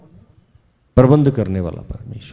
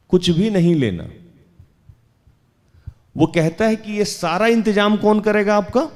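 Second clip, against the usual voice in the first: high-pitched, insistent preacher's voice; raised sermon delivery; emphatic preaching; 7 semitones higher than usual. Real, not acted. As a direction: press the question on the congregation.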